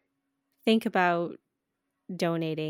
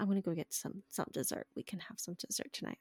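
hushed dad quote; 11 dB quieter than usual. Retold or imitated. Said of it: "He's like".

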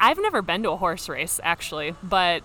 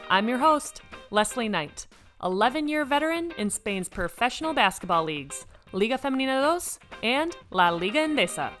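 podcast intro; 4 semitones higher than usual. Acted. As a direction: announce the show like a host.

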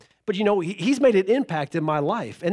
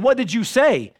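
firm parent; 6 semitones higher, 4 dB louder than usual. Retold or imitated. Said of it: "you get firm and you get angry".

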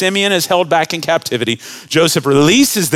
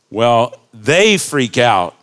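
ordinary talk, nothing marked

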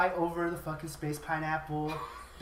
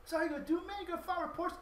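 much higher, softer taunting voice; mocking suspect; 11 semitones higher, 7 dB quieter, faster than usual. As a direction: taunt him in a lightly voiced high tone. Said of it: "He's like".

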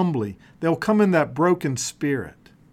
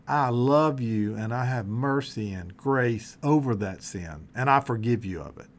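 God's promise, much lower and softer; 9 semitones lower, 5 dB quieter than usual. Acted. Preacher voicing God's gentle promise to the congregation, low and soft. Preacher voices God forgiving sin.

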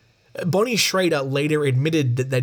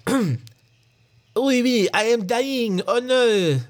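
high-pitched mock-French voice; comic French accent, pitched way up; hammed-up French accent; 9 semitones higher, slower than usual. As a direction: play a dying Frenchman, high and overdone. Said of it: "That's my French accent".

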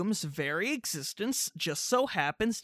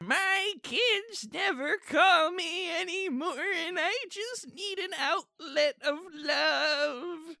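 higher, slow, quavering lonely grandma; 7 semitones higher, 4 dB quieter, slower than usual. Acted. Grandma lamenting her kids never call, mock-tearful.

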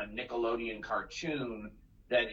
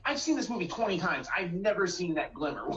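high-pitched mock protest; exasperated retort; 7 semitones higher than usual. Fan disputing what they read, exasperated. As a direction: object in a higher, incredulous voice. Retelling own exasperated objection.

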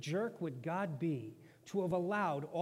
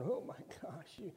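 high, alarmed friends voice; panicked friends; 6 semitones higher than usual. Acted. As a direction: gasp at Job's daring. Friends panic at Job yelling.

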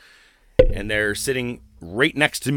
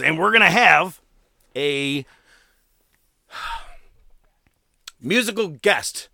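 higher, drawn-out build-up with suspense pauses; ring announcer; 6 semitones higher, 3 dB louder than usual. Retold or imitated. Promoter announcing a musical guest, suspenseful. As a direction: tease the reveal to the crowd.

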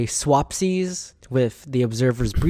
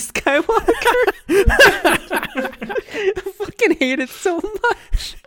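laughing; much slower than usual. Real, not acted. Laughing rant about the movie.

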